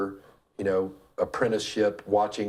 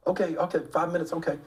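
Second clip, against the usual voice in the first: higher-pitched quick phone replies, imitated; retold phone call; 8 semitones higher, faster than usual. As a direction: act out wrapping up a phone call. Retold phone call to his ride.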